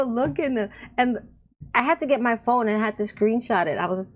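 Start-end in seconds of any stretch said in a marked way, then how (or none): none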